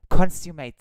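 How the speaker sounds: consummate blurted out loud and high once it finally comes; triumphant loud pronunciation. Real, not acted. Vlogger finally gets the word out, loud and triumphant. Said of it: "I can't even read".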